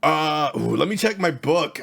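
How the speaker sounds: high, loud, eager innkeeper voice; overly friendly desk clerk, straining upward; cheery hotel clerk. Acted. GM plays Teddy the clerk.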